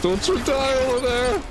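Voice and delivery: urgent shout. Real, not acted. Healer yelling at teammate mid-fight.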